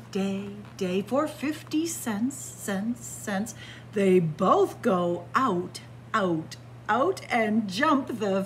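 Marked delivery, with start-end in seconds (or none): none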